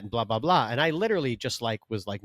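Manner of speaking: ordinary talk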